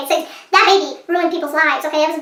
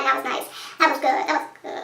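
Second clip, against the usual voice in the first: satisfied murmur; 5 semitones lower, 9 dB quieter than usual. Real, not acted. Quiet satisfaction at a death.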